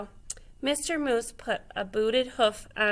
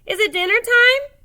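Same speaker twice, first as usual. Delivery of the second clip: squeaky, high and loud little sheep; 12 semitones higher, 9 dB louder than usual. A narrator's reading. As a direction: pipe it up like an eager sheep. Sheep asks eagerly.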